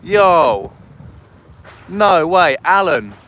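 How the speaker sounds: loud, stretched "Yo, oh my God" at the donation; excited shout. Real, not acted. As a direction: blurt it out, thrilled by the gift. Big super chat arrives.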